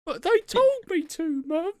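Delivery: very high mock kiddie protest; child voice. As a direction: answer mum like a squeaky little boy. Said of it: "He is a child".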